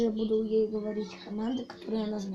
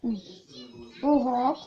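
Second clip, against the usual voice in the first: mouth full; 2 semitones higher, 5 dB louder than usual. Real, not acted. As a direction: praise and commands through a mouthful. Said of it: "Сложно говорить".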